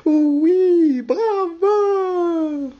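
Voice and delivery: high squealing bravo; gushing cheer. Acted. Madame Balanda gushing over the singers, high and excited.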